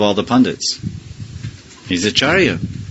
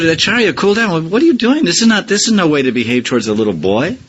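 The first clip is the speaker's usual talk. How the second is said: put-on higher, scolding young friend; voicing Gadadhar; 7 semitones higher than usual. Gadadhar scolding the Acharya for bowing to a boy, high-pitched. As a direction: pitch up and chide the old Acharya. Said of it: "he said".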